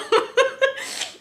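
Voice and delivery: high embarrassed giggle; high laugh. Real, not acted. Laughs recalling falling down.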